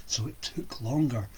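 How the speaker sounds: plain reading voice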